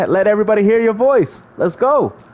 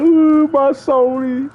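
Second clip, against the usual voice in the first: mock crying fan; 4 semitones higher, slower than usual. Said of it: "Best of Philly crying".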